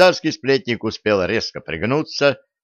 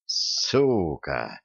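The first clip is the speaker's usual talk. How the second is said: low, soft hateful exhale; hateful breath; 5 semitones lower, 5 dB quieter than usual. Acted. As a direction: spit it out quietly with hate. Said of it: "с ненавистью выдохнул".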